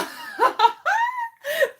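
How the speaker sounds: high shaky laugh after a scare; high nervous burst of laughter. Real, not acted. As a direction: laugh off the fright, high and breathless.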